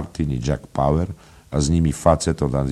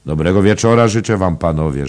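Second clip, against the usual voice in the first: raised, louder servile welcome; obliging greeting; 5 semitones higher, 7 dB louder than usual. A narrator's reading. Innkeeper bowing to arriving guests, obliging greeting. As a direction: welcome grand guests eagerly and deferentially. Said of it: "rzekł z usłużnym pokłonem".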